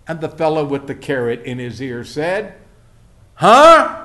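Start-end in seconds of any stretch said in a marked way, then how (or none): 3.4-4.0 very loud, high "Huh?"; yelled query, as if deafened; uncomprehending huh. Retold or imitated. Carrot-eared man still not hearing, loud uncomprehending yell.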